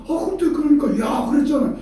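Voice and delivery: high-pitched, insistent protest; mock exasperated reproach. Preacher acts quoting the promise back, high exasperated reproach. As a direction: press the point in a played-out squabble. Acted reproach in retold argument.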